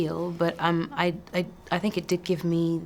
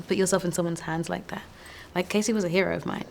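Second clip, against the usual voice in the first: normal talking voice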